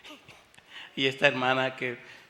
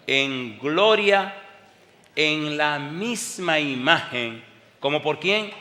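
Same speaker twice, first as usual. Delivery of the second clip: full-voiced preaching; 2 semitones higher, 7 dB louder, much slower than usual. Pastor calling out the verse to his congregation, loud slow preaching. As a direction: call it out and drag the words for the crowd.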